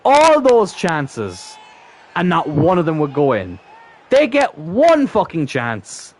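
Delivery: loud, high, drawn-out disbelief; exasperated rant. Real, not acted. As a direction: rant slowly, punching each phrase. Ranting after conceding a goal.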